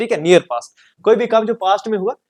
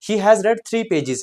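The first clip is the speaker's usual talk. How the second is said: slow dictation; 3 semitones higher, much slower than usual. Shown as a demonstration. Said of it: "teacher dictates example".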